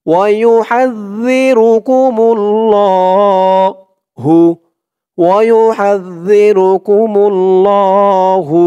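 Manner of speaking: slow, drawn-out Quranic chant; tajweed recitation. Shown as a demonstration. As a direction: recite it slowly with long held vowels. (ধীরে টেনে কুরআন তিলাওয়াত)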